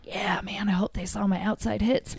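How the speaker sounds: whispered, hopeful among teammates; hushed whisper. Retold or imitated. Players whispering hopefully in the huddle. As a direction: breathe it out like a secret. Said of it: "And we're all like".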